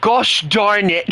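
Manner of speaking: slow mock British gosh darn it; drawn-out fake British huff; mock British exclamation. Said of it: "and say".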